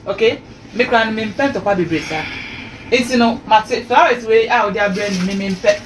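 ordinary talk, nothing marked

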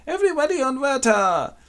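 put-on high, loud children's cry of glee; excited kids shout. Siblings call to pile onto Werther, shrieking high and loud. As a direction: squeal it like kids charging.

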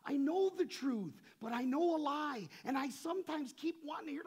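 loud, high-pitched sermon delivery; impassioned preaching. Real, not acted. Preacher explains God's unreachable presence to the congregation, impassioned. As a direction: preach it out at full force.

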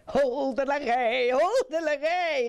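high, sing-out nonsense cry; merry call. A narrator's reading. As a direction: call it out gleefully on entering. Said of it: "riep hij".

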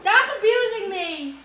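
mock protest. Playful protest at teasing friend.

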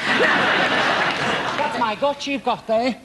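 high, slow, laughing squeal; squealing surprise. Acted. Assistant seeing her gut on the table, high slow squeal. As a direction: shriek in mock horror.

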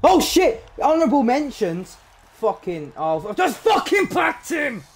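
very high, loud shocked shout; shocked excitement. Real, not acted. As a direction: explode with disbelief. Big pack pull.

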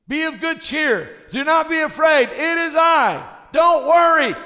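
loud, high preaching cry; full-force preaching. Acted. Preacher thundering to the congregation, high and loud. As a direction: preach it out to the crowd.

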